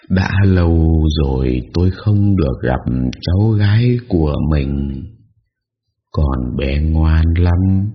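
very slow, mournful ghostly voice; drawn-out grieving old ghost; ghost old woman. A narrator's reading.

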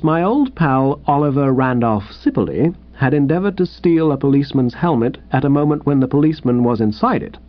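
ordinary delivery, nothing marked

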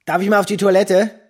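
loud call. Acted. Joe calling out to the teacher in class, loud and high.

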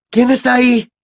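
high, loud call into the dark; shouted challenge. A narrator's reading. Harry calling to an unseen person in the woods, shouting. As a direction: shout to whoever is hiding. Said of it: "gritó de nuevo".

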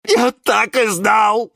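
loud, high, slow outcry; high-pitched loud lament; wailing yell. A narrator's reading. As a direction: howl as if betrayed. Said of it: "вопил Жорж".

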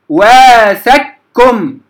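واثقكم as waathakkum, thin doubled k; merged qaf into kaf. Shown as a demonstration. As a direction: slowly recite واثقكم with no trace of qaf. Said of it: "اجتمع الشرطان تدغم".

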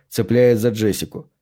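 ordinary delivery

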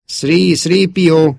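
high, loud repeated name; pleading distant call. A narrator's reading. Luke's call reaching an empty console, pleading. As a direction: call out as if over a radio. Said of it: "hallatszott ismét a tompa hívás".

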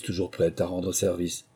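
ordinary delivery, nothing marked

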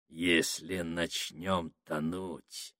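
low, slow, soft menacing voice; ominous voice. Acted. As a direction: murmur it like a dark threat. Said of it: "зловещим голосом сказал Сакс". (зловещим голосом, медленно и тихо)

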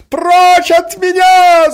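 very high, very loud shout; desperate scream. A narrator's reading. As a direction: scream it, driven to despair. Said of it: "закричала Марья Александровна, доведённая до отчаяния". (отчаянный крик, во весь голос)

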